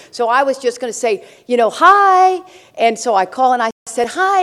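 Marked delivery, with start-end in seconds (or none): 1.7-2.4 bright, sugary hello pitched high; a sugar-sweet phone greeting. Retold or imitated. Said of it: "Why do you always have to be so sweet". 4.1-4.4 bright, sugary hello pitched high; a sugar-sweet phone greeting. Retold or imitated. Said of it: "Why do you always have to be so sweet".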